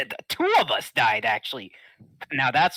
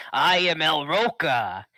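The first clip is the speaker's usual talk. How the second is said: lucha libre announcer; 4 semitones higher, 5 dB louder, slower than usual. Acted. Wrestler introducing himself loudly.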